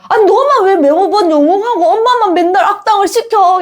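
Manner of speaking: high-pitched whiny mum protest; mock complaint. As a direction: act a mum grumbling that it's unfair. Mother's complaint acted as bad example.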